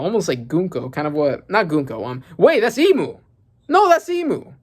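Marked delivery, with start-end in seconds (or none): 2.4-4.5 high, loud, excited realisation; sudden realisation. Real, not acted. Fan spots Emu in the panel, excited realisation. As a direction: blurt it out, climbing higher and louder.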